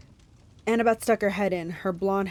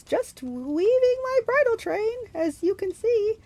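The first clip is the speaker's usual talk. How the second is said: drawn-out falsetto, sugary fake bride; slow, very high put-on bridal voice; Grover; 14 semitones higher, slower than usual. A narrator's reading. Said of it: "Grover said in his falsetto voice".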